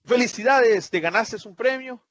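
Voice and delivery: high, bright with admiration; joyful congratulations. Shown as a demonstration. Teacher showing an exclamation said with joy. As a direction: congratulate them with real joy. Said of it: "no es lo mismo como decirle".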